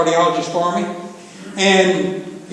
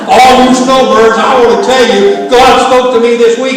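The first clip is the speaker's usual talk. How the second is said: loud, higher, hollered out over the laughter; playful loud proclamation; 6 semitones higher, 10 dB louder than usual. Real, not acted. Preacher teasing the snowbirds about God's southern accent, loud playful proclamation. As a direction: crow it loudly to the whole room. Joking boast to laughing congregation.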